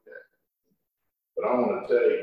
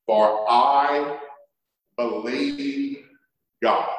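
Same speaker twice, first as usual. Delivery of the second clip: slow, solemn King James reading; scripture reading; 4 semitones higher, slower than usual. Acted. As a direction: read the angel's words with weight. Preacher reads Acts scripture aloud.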